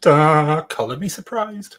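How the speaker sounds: high, slow, sarcastic sing-song; mock surprise. Co-host mocks the psychic's failure, high and sarcastic. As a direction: ham up fake astonishment.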